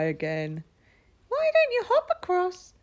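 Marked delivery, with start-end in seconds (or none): 1.3-2.7 very high, louder squeaky frog. Acted. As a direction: ask brightly like a tiny frog. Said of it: "asked the voice".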